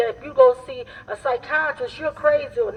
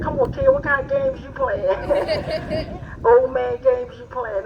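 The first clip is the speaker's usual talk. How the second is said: slow, laughing uncle voice; booming tipsy uncle; 3 semitones higher, slower than usual. Retold or imitated. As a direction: drawl it like a teasing uncle. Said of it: "in your other voice".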